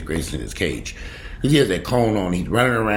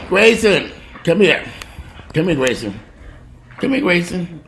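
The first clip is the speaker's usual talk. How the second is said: loud, high-pitched summons; calling the dog; 13 semitones higher, 4 dB louder than usual. Real, not acted. Owner summons Grayson from over there, loud high call. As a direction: call out firmly to a dog across the room.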